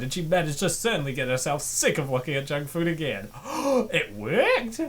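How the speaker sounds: ordinary talk, nothing marked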